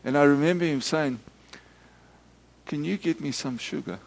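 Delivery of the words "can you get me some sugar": small request. Retold or imitated. Man's anticlimactic small request.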